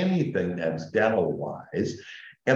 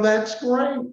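much higher, bright chirp to the receptionist; cheery "great"; 3 dB louder than usual. Retold or imitated. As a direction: chirp it high, delighted at bad news. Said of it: "And I go".

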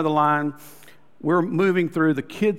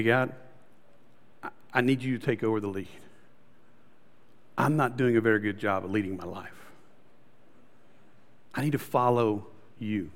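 humble prayer; 8 semitones lower, 8 dB quieter than usual. Retold or imitated. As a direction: lower and softer, a sincere plea.

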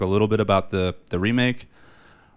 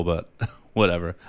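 ordinary talk, nothing marked

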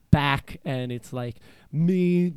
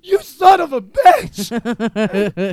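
loud, high, slow cry of frustration; anguished yell; 8 semitones higher, 10 dB louder, much slower than usual. Retold or imitated. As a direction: wail it at the missed chance. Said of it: "And I was like".

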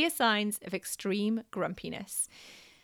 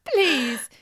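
pleading louder, more insistent; 4 semitones higher, 7 dB louder than usual. Retold or imitated. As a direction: beg harder. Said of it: "mum pleading with son".

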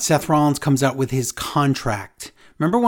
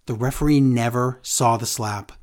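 ordinary delivery

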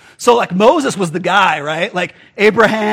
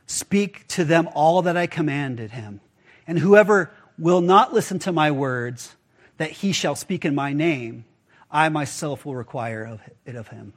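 scripture reading; 4 semitones lower, 4 dB quieter than usual. Acted. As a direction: read the verse solemnly, letting it sink.

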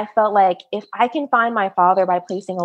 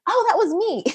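much higher, giddy reveal; spring the surprise on her; high, playful that was me; delighted reveal; 13 semitones higher than usual. Retold or imitated. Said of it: "And I was like".

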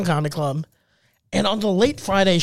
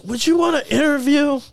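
imitated slurring, pitched up; drunken slur; 7 semitones higher than usual. Imitating the drunk man proposing an interview, slurring. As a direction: run the words together like a drunk. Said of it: "like, slurring".

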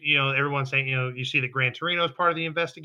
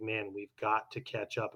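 low, much softer deadpan delivery; subdued Todoroki quote; 5 semitones lower, 12 dB quieter than usual. Retold or imitated. Imitating Todoroki fretting about falling behind, low and quiet. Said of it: "Todoroki's saying is like".

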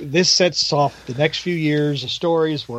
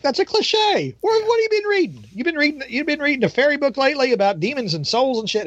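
much higher, far-off taunting voice; mocking demon; 10 semitones higher than usual. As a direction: scoff at the cliche in a high demon voice. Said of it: "the demon wants nothing".

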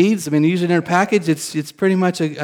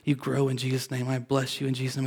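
quiet blessing; 2 semitones lower, 10 dB quieter than usual. Retold or imitated. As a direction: murmur a prayer over the seeds. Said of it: "I'm speaking to 'em".

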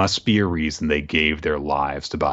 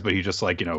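normal talking voice

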